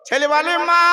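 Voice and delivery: loud, soaring sermon chant, high and ringing; full-force preaching. Acted. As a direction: preach it out to the whole crowd.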